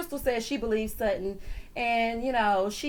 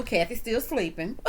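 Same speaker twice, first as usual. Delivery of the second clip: ordinary delivery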